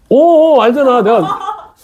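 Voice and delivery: high, excited, laughing exclamation; amused outburst. Real, not acted. Teacher catching students in on his joke, laughing. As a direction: burst out, loud then easing, through laughter.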